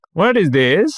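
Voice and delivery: put-on high, Indian-accented question. Scammer questions the link he was sent, imitated Indian accent. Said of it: "this dude had an Indian accent".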